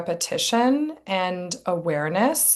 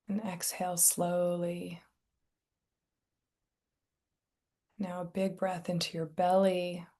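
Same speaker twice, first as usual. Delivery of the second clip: guided meditation; 7 dB quieter than usual. Real, not acted. Meditation teacher settling listeners into their bodies, soft guided meditation. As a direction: ease them gently into stillness, softly and unhurried.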